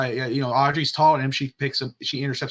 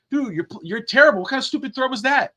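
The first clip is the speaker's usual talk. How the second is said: mock angry teammate; 10 semitones higher than usual. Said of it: "you can't go on and say".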